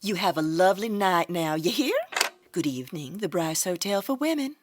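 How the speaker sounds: brisk routine phone answer; Southern desk clerk. Acted. Desk clerk answering next call.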